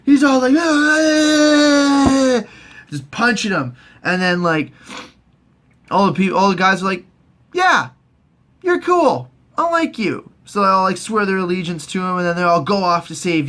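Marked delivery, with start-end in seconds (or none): 0.6-2.4 long, high, loud mock scream; frenzied battle yell. Theon punching wildly. 7.5-10.3 high-pitched chorus of approving guys; mock approval. The guys accept Theon.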